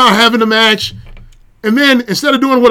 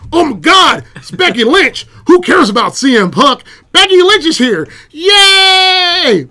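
cheering crowd; 7 semitones higher, slower than usual. Retold or imitated. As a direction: cheer like a thrilled arena crowd. Crowd cheering Becky's return.

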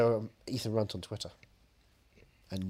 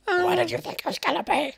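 silly high voice. Acted. Continues the silly put-on voice.

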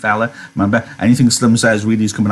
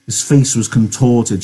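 ordinary delivery

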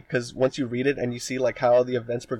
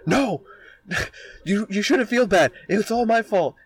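high, frantic rush of apology; hammed-up girl bursting in, begging him not to feel bad; mock frantic apology; 8 semitones higher than usual. Katarina breaks down his door, frantic and high. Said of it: "breaks down his door with an axe and be like".

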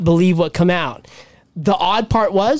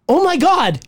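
retold gasp of shock; surprised; 8 semitones higher than usual. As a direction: say it amazed. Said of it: "I was like".

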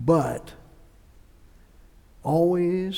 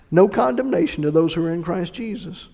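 ordinary talk, nothing marked

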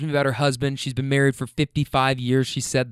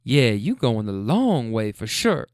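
slow granny tease, words stretched; needling granny; 4 semitones lower, slower than usual. Retold or imitated. Granny returning to her complaint, slow imitated needling. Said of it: "she comes back to it again".

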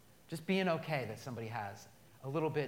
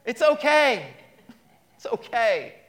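louder repeat; 8 semitones higher, 13 dB louder, slower than usual. Shown as a demonstration. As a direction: echo it back louder and higher. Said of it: "Everybody say".

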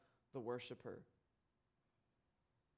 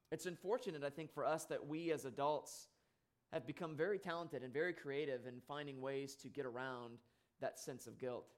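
ordinary talk, nothing marked

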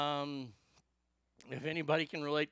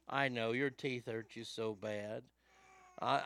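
ordinary talk

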